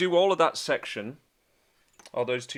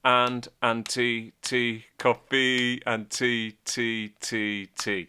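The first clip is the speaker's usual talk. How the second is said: slow spoken rhythm; much slower than usual. Shown as a demonstration. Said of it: "that thunder there was coffee".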